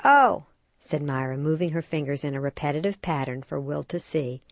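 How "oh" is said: high, loud bright oh; light realization aloud; knowing oh. Acted. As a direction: mom catching on to her son. Mother realizing.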